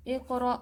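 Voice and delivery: اقرأ with a sharp glottal catch on the hamza; Quranic recitation. Shown as a demonstration. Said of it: "اقرأ جھٹکا".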